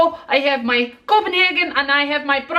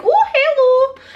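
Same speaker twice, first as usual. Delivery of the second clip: high, loud mock-flirty greeting; a sudden coy hello, pitched way up; 11 semitones higher, 5 dB louder, slower than usual. Acts out the clown look.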